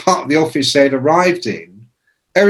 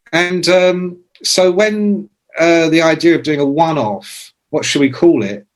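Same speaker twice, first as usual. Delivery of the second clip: normal talking voice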